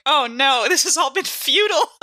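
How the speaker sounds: high, laughing mock despair. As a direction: wail it through a laugh at your own doom. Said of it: "And I was just like".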